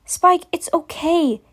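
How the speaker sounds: gentle comforting mare; reassuring. A narrator's reading. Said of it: "assured Twilight ... more soothingly".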